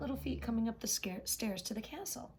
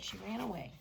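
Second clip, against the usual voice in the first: ordinary delivery, nothing marked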